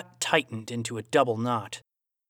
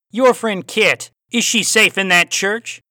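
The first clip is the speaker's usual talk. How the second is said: louder, raised Aussie voice with a cowboy twang; Aussie cowboy accent; 7 semitones higher, 8 dB louder than usual. A narrator's reading. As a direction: play the villain Aussie, hint of cowboy twang. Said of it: "Aussie accent with a hint of cowboy twang".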